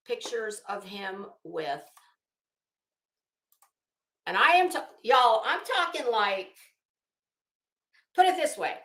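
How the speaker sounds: ordinary talk, nothing marked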